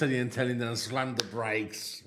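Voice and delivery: ordinary delivery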